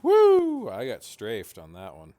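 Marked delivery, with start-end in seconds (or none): 0.0-0.7 loud, very high cheer; excited whoop. Real, not acted. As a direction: whoop like you just won. Cheering after a kill.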